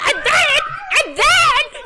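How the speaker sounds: high, loud mock-toddler squeal; imitating a child eager to keep telling; over-excited toddler. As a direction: gush like an overexcited little kid. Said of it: "like a toddler when they get a little bit of praise".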